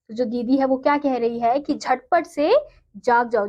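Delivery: ordinary talk, nothing marked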